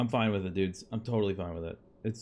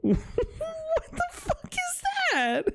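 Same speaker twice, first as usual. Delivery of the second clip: slow, very high laughing squeal; much slower than usual. Real, not acted. Cracking up at price.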